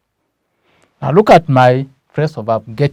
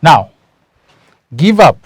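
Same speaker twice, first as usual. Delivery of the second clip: loud emphasis; 3 semitones higher, 9 dB louder than usual. Shown as a demonstration. Teacher calling the phrase out to students, loud. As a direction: give up said loud and high.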